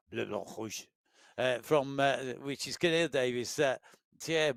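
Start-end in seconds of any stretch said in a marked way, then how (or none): 0.1-0.8 French accent. Shown as a demonstration. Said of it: "in my best French accent".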